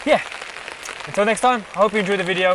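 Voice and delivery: loud, projected over the rain; loud outdoor sign-off. Real, not acted. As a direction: call it out to the camera outdoors. Outdoor outro against the rain.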